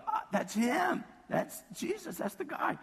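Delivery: high, excited acted exclamation; excited recognition. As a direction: act out spotting him, voice leaping up. Voices woman recognizing Jesus.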